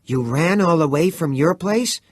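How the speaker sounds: you can't believe he ran that far; unbelieving. Acted. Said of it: "Chris asked unbelievingly".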